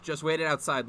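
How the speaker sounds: ordinary delivery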